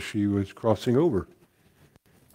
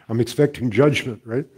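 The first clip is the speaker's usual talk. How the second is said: usual speaking voice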